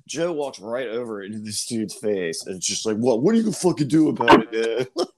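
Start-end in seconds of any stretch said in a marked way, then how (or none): 3.0-5.1 louder, aggressive mock threat; tough guy challenge. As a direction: square up like a bar brawler. Brother confronting manager.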